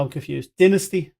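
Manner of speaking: usual speaking voice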